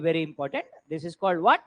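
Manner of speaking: ordinary talk, nothing marked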